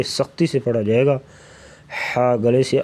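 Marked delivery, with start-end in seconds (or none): none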